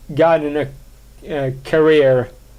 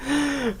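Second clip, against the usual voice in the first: high laugh; 10 semitones higher, 8 dB quieter than usual. Real, not acted. Laughs after the bit.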